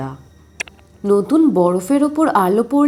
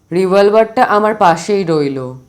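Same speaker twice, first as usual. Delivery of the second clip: lightly lower, steady uncle voice; Kakababu's voice; 5 semitones lower, 4 dB louder than usual. Acted. Narrator voicing Kakababu to Santu, lightly lower.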